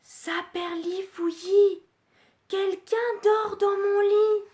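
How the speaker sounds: high, slow hushed little cub voice; a small secretive cub's voice; Little Bear whispering. Acted. Little Bear leaning in over his bed, high and hushed. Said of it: "se penche et chuchote".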